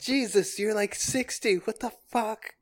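put-on disgusted little boy. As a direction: recoil like a grossed-out kid. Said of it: "little boy Tim".

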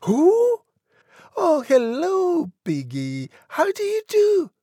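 put-on very high falsetto girl on the phone; extremely high, chatty phone voice. A narrator's reading. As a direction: answer in a piping, sociable falsetto. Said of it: "she said".

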